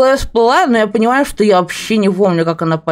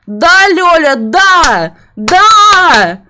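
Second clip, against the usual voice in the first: excited shout; 8 semitones higher, 7 dB louder, much slower than usual. Real, not acted.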